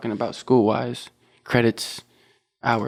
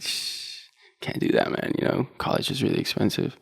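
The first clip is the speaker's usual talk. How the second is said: breathy half-whispered, much softer; a hushed retold aside, softer and unvoiced; 8 dB quieter than usual. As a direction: mutter it under your breath like a confession. Retold hushed reply about cost.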